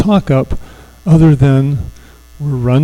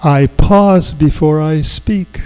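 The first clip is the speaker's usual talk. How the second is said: slow affirmation to repeat; slower than usual. Shown as a demonstration. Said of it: "you're gonna repeat it after me".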